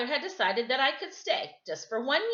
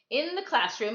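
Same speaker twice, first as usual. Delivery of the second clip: ordinary delivery, nothing marked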